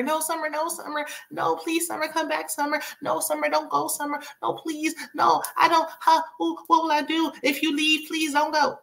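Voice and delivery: high-pitched, whiny begging in a rush of repeats; hammed-up desperate plea to stay; mock pleading. Mimics Noelle begging Summer to stay.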